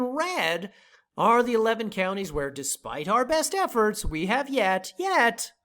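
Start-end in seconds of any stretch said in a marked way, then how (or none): 5.0-5.5 yet pitched up high and hit hard; stress on yet. Shown as a demonstration. Host stressing yet to viewers, punched high. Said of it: "operative word".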